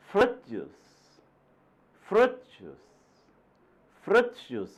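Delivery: teacher drills fruit juice stress. Shown as a demonstration. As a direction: lift fruit, drop juice. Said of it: "You see the stress on the first word".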